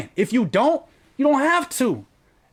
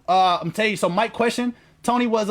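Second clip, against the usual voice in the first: normal talking voice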